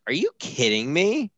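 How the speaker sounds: high incredulous question; retold disbelief. Wife reacting to husband's fall, mock incredulous.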